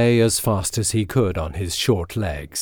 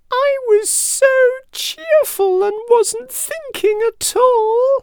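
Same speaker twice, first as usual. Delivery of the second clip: frightened mouse squeak; 4 dB louder than usual. A narrator's reading. Mouse excusing himself to the lion, frightened squeak. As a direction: plead in a tiny, scared mouse voice. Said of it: "squeaked again in a frightened voice".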